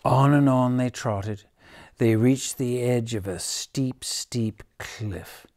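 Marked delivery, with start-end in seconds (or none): none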